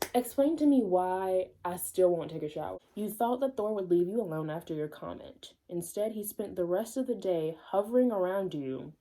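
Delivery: ordinary delivery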